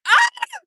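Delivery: high, loud cry-laugh; screaming laugh. Real, not acted. Losing it at her own roast, screaming laugh.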